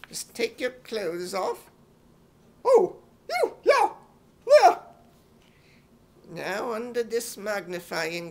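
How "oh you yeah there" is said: very high, loud squeal of shock; shrill squeal. Acted. Crun squeals at being examined.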